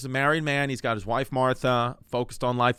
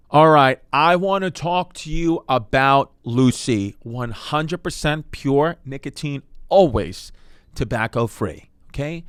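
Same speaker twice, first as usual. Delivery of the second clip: slow, loud sponsor pitch; hyped-up ad read; 7 dB louder, slower than usual. Acted. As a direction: sell it big and deliberate.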